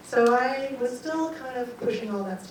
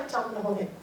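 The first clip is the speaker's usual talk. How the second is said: usual speaking voice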